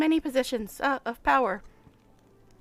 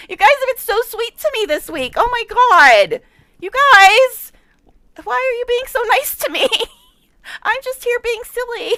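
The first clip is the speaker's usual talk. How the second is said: loud, high, flustered gushing; thrilled and a bit overwhelmed; flustered excitement; 8 semitones higher, 8 dB louder than usual. Real, not acted. Flustered by donations.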